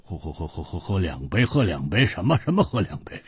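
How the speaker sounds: stammered echo, starting very soft and building; sputtering disbelief. A narrator's reading. Drinker scoffing at two cups, sputtering. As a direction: splutter the offer back, incredulous. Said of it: "胡铁花瞪着眼说".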